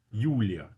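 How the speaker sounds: "Yulia" said as Russian "yoo-lya"; Russian name demo. Shown as a demonstration. Teacher pronounces the Russian name for students. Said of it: "in Russian is Yulia".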